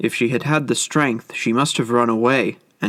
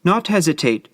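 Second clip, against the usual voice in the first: a higher, urgent cry of protest; cried out; 6 semitones higher than usual. Acted. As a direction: protest quickly, pitch jumping up. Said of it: "cried Kokua".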